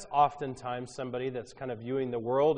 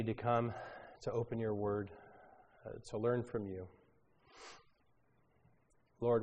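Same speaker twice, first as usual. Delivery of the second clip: prayer; 2 semitones lower than usual. Acted. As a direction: pray gently to the congregation. Leads a prayer.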